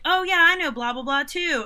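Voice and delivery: mock one-upping brag; sarcastic retort. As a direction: toss it back sarcastically. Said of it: "when I'm like".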